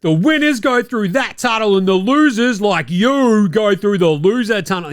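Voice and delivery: hammed-up pundit lecture; mock Windhorst rant. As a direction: lecture like a TV insider scolding. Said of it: "He's like".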